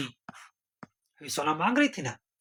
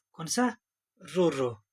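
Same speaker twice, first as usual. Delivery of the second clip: ordinary talk, nothing marked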